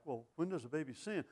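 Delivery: soft, wondering question, voice lifting on sin; puzzled listener. Retold or imitated. Preacher voicing a puzzled churchgoer, soft wondering question. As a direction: voice a puzzled congregant asking it gently. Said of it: "that look on your face like".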